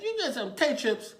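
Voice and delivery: high, loud echoed question; humoring a child up high; talking to a child. Retold or imitated. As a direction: play up surprise for a little kid. Officer echoing a small child.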